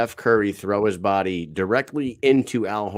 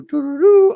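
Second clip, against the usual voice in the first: high loud bugle doo doo; trumpet fanfare; 12 dB louder than usual. Pretend. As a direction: toot it like a trumpet.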